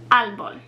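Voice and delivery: "árbol" with AR stressed, falling away; stress on first syllable. Shown as a demonstration. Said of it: "puts the emphasis on that syllable".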